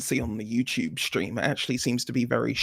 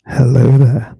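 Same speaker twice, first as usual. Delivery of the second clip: loud, booming hello; booming greeting; 10 dB louder, slower than usual. Pretend. Co-host to host after being boosted, booming. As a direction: greet the room at full volume.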